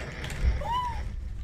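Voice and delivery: high-pitched shrieking gasp; real frightened squeal of a gasp; startled gasp. Real, not acted. Scared while playing horror game.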